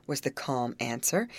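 ordinary delivery, nothing marked

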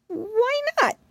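put-on high, fretful little girl voice; a tiny frightened mouse wailing; timid mousy girl. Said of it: "asked the mousy girl".

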